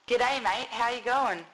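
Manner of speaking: cheery Australian "G'day, mate" with a lift; chirpy Australian slang greeting; Aussie greeting. Shown as a demonstration. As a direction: greet like a true-blue Australian. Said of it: "goes like this".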